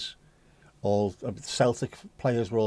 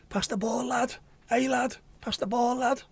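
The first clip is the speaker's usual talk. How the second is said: high, mock Scouse footballer shouting for the ball; Scouse lad calling for a pass, pitched way up; mad Scouse accent; 12 semitones higher than usual. Imitating a Celtic teammate in a mad Scouse accent. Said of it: "with his mad Scouse accent".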